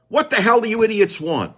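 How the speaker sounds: exasperated bellow; fed-up radio host blowing up; angry shout. Real, not acted. Ranting at callers.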